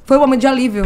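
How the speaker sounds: normal talking voice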